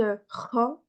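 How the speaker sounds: normal talking voice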